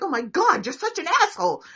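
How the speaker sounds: loud, fast mock exasperation; exasperated accusation. Friend fed up with someone, loud exasperated mock yell. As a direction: throw up your hands and yell it at them.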